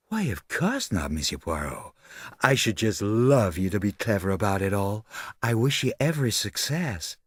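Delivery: airy, gushing society lady; breezy socialite. Acted. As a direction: wish them well without caring.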